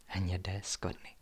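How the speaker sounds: slow, drawn-out whisper; hushed and very low, lingering on each word; ominous whisper. A narrator's reading. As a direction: whisper it slowly with dread. Ominous repeat of the discovery.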